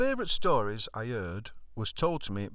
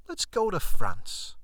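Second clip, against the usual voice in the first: bold suggestion; 9 semitones higher, 8 dB louder, slower than usual. Retold or imitated. Drunk mate's bold suggestion.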